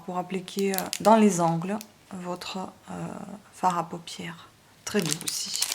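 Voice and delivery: normal talking voice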